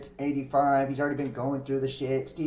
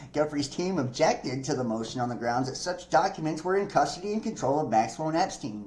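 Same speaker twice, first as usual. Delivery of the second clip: normal talking voice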